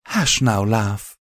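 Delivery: a tender mare cooing. A narrator's reading. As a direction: hush him like a crying child. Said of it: "Velvet cooed".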